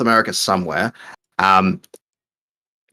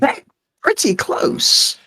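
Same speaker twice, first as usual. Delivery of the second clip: squeaky high quip; much slower than usual. Real, not acted. Teasing reply to question.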